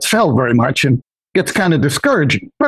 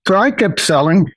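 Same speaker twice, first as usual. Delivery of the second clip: normal talking voice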